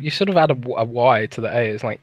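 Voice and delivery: ordinary talk, nothing marked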